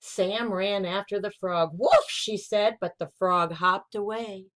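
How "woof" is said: high, loud puppy bark; puppy woof. Acted. Puppy chasing a frog, yippy woof. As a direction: give a quick eager yip.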